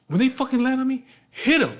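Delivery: loud, high mimicked order; heated command. Father telling son to hit landing flies, heated command. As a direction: imitate emphatic fed-up instructions.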